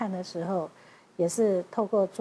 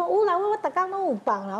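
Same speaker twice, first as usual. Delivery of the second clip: laughing imitation of old patient; 9 semitones higher than usual. Voicing the old patient's reply.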